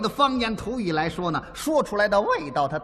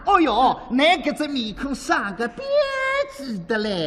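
coquettish, cooing Suzhou accent; cutesy Suzhou dialect; 3 semitones higher than usual. Shown as a demonstration. Comic demonstrating a Suzhou compliment for his partner, coquettish Suzhou dialect. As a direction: coo it sweetly in Suzhou dialect. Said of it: "又轻又柔又软，还又嗲".

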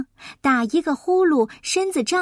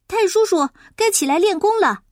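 put-on higher, louder boy's call; 6 semitones higher, 5 dB louder than usual. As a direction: call him out of bed. Boy calls him to get up.

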